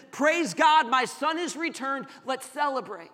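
loud, high cry of rejoicing; jubilant father. Acted. Prodigal's father welcoming his son home, jubilant. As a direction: proclaim it joyfully, at full preaching force.

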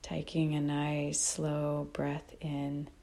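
calm, unhurried meditation guide with long pauses; guided meditation. Real, not acted. Teacher guiding listeners to notice breath and body, calm meditation voice. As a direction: guide them gently, phrase by phrase, leaving long silences.

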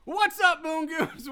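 high, loud showman hello; big podcast host welcome; hyped greeting. Real, not acted. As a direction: greet the fans like a hype host.

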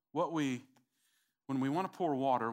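normal talking voice

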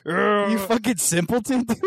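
slow, high teasing jab; mock insult. Real, not acted. Mocking insult.

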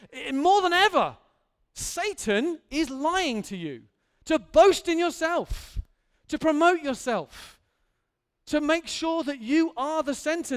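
loud, high, slowed warning; a preacher raising the roof; full-force preaching. Real, not acted. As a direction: hammer the warning home.

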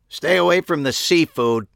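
sharp loud bark, high and forceful; barked warning. Acted. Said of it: "a voice barked at me".

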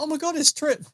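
a gasp of recognition at a familiar face; surprised. Retold or imitated. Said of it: "and I was like".

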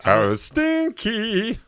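very high, drawn-out cartoon squeal; silly high-pitched cartoon voice; cartoon character impression. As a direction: stretch it out high and loud. Cartoon impressions of Ren and Stimpy.

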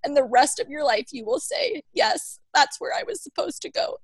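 a mother tearfully reading the poem; reading through tears. Real, not acted. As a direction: push on through real crying. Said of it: "I always cry".

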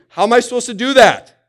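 a worn-out believer protesting, high and loud; exasperated listener. Pretend. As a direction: throw up your hands at an impossible command. Voicing a hearer's frustrated objection.

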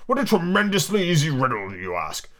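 high-pitched grumble, dropping at the end; a touch of a growl; mildly growled. A narrator's reading. Humpty dismisses Alice's riddle, mildly growling. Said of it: "Humpty Dumpty growled out".

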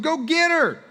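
high, loud challenge; defiant dare. Retold or imitated. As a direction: call the kid's bluff. Dad calling son's bluff.

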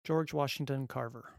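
usual speaking voice

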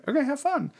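a mock high, airy partner voice; partner's breezy reply. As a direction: toss it off lightly like the partner. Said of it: "And he's like".

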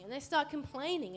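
normal talking voice

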